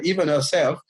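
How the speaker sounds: normal talking voice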